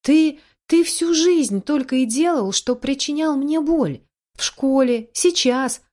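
high, hurt, angry outburst; heated accusation. A narrator's reading. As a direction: let old pain burst out, pitch up. Heated accusation continues after shouting. (гневный упрёк, высокий голос)

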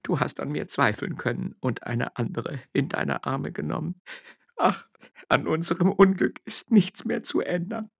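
high, tearful lament. Acted. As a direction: grieve over the lost love. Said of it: "weinte zum ersten Male in ihrem Leben". (weinend klagend)

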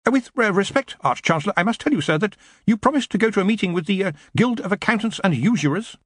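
high, hurried, tentative underling; nervous wizard's aide, high-voiced; anxious assistant.

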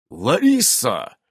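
slow, drawn-out solemn address; formal, stately call. A narrator's reading. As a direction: pronounce it with ceremony. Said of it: "торжественно произнёс Иннокентий".

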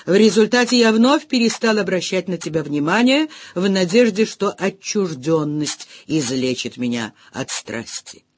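usual speaking voice

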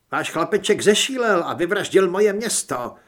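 loud, high, angry snap; snapped accusation. A narrator's reading. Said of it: "vyštěkla Heather". (vyštěknuté, hlasité obvinění)

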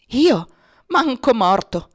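loud, slow, high outraged refusal; horrified refusal. A narrator's reading. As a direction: balk at the task, loud and appalled. Babalù refuses to cut fingers. (rifiuto inorridito, lento e forte)

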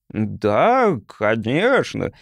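slow, hesitant gurgling alien; unsure. A narrator's reading. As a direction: drag out a doubtful yes. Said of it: "неуверенным тоном вымолвил проводник".

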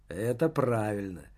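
ordinary delivery, nothing marked

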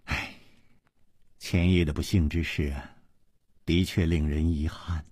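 low, soft sigh into an apology; sighing regret. A narrator's reading. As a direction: sigh softly, then speak with hushed regret. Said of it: "叹了一口气". (轻声叹息，低柔惋惜)

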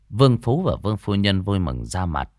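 usual speaking voice

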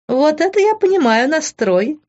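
high, delighted exclamation; amused delight. A narrator's reading. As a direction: cheer the attitude on with amusement. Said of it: "рассмеялась Кристал". (весело, высоким голосом)

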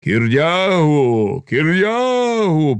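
long, climbing cry; rallying crowd cry. A narrator's reading. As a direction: stretch the name out over everyone. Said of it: "покрило всіх".

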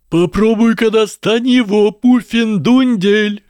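high, jeering yell, last word stretched; yelled taunt. A narrator's reading. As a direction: bawl a taunt from afar. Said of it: "заорал мистер Гойл". (орать, дразня)

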